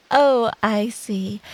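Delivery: ordinary talk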